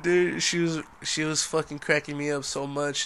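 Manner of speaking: usual speaking voice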